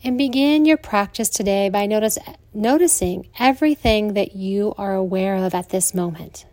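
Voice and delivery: soothing Yoga Nidra guidance with long pauses; guided meditation. Acted.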